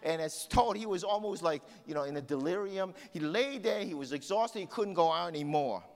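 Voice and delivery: high, loud sermon storytelling; impassioned preacher telling a story; fired-up preaching. Acted. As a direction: preach the rescue with rising urgency. Preaches the rescue story fervently.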